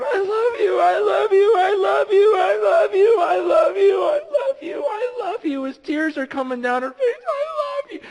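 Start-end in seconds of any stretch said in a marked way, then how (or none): 0.0-5.6 screaming love through tears; overwhelmed daughter sobbing and shouting; crying scream. Retold or imitated. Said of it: "just screaming". 7.3-8.0 screaming love through tears; overwhelmed daughter sobbing and shouting; crying scream. Retold or imitated. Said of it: "Just crying and screaming".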